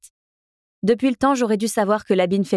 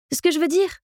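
bright and much higher, cheery; cheerful; 9 semitones higher than usual. A narrator's reading. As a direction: chirp it cheerfully over his threat. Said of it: "continuai-je gaiement".

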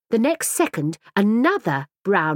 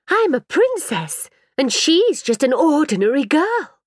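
indignant princess; 8 semitones higher, 4 dB louder than usual. A narrator's reading. Mirabelle correcting the woman, indignant and high. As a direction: insist on royalty, offended. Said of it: "said Mirabelle indignantly".

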